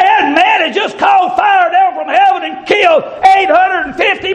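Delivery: high, shouted revival preaching; preaching at full force. Acted. Preacher rousing the congregation, full-force shouted preaching.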